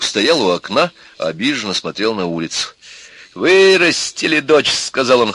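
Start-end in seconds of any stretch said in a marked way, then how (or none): 3.4-4.7 high, loud, slow complaint; bitter reproach. A narrator's reading. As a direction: lament it with indignation. Father bitterly reproaching the family. (горький громкий упрёк)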